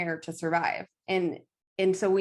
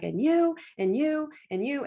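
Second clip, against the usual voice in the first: mock tantrum; 7 semitones higher than usual. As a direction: point at each person, hammed-up Aries fury. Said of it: "an Aries is gonna throw a tantrum".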